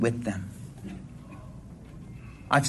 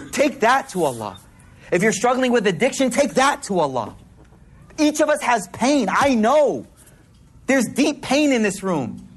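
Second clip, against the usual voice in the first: higher, urgent sermon voice; impassioned preaching; 8 semitones higher than usual. Acted. Preacher urging the crowd to bring their pain, impassioned. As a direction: plead with the room.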